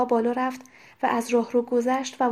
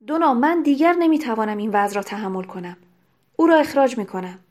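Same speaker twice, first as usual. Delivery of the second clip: louder, heated complaint; a furious husband venting; louder, angry outburst about the missing servant; 3 semitones higher, 5 dB louder than usual. A narrator's reading. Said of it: "هاری با خشم گفت".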